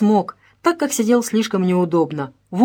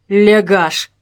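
loud one-word insult; contemptuous; 6 dB louder than usual. A narrator's reading. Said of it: "с презрением плюнул на пол". (презрительно, громко)

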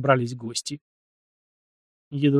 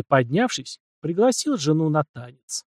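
usual speaking voice

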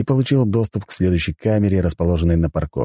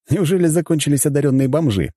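mildly teasing, pitched-up question; wry chuckle; 8 semitones higher than usual. A narrator's reading. Count teasing the deity about recruits, amused. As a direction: ask it with a wry smirk. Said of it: "усмехнулся я".

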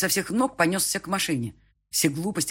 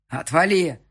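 much louder angry bark; barked retort; 3 semitones higher, 9 dB louder, slower than usual. A narrator's reading. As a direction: bark him off. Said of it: "рявкнул снова я". (рявкнуть)

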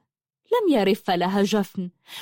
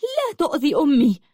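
high, protective angry shout; angry cry; 6 semitones higher than usual. A narrator's reading. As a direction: cry out in anger to defend mother. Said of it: "وصاحت غاضبة". (صيحة غاضبة دفاعًا عن الأم)

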